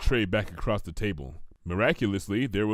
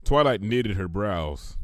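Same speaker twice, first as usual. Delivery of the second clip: plain reading voice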